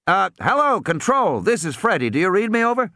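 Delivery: high crackly radio caller. Acted. Captain hailing over the radio. Freddy radio call again.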